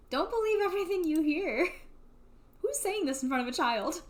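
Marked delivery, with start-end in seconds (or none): 0.1-1.7 higher, laughing through the comfort; laughing reassurance. Acted. Big brother soothing his little brother, laughing through it. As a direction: comfort him while cracking up.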